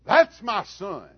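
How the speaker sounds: loud, high, stretched-out proud yell; proud holler. Retold or imitated. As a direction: shout it from the bleachers at a home run. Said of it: "I'd holler".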